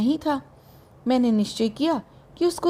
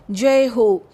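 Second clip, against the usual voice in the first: louder acclaim, as from a crowd; joyful cheer; 6 dB louder, much slower than usual. A narrator's reading. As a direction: cheer the king out loud. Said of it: "सबने अत्यंत आनंद से". (आनंद भरा जयघोष)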